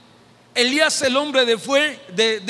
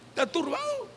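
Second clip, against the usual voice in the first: ordinary talk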